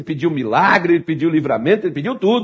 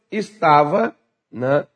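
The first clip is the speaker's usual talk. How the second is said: ordinary delivery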